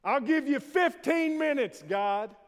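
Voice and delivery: high, loud shout flung at the ceiling; taunting shout. Acted. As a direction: professor shouting a challenge up to God. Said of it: "he shouted".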